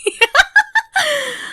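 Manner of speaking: high, unhinged little laugh; shrill creepy giggle. Acted. Captor laughing over the terrified captive, high creepy giggle. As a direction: giggle like it's all a game.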